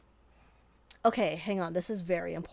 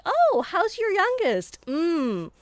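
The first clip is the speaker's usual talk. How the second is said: nosy relative voice; 10 semitones higher, 7 dB louder, slower than usual. Retold or imitated. Voicing relatives asking about her.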